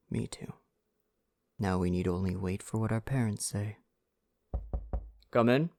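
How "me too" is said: a hushed, loving agreement; soft whisper. Acted. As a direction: whisper it tenderly. Tender quiet reply to lover.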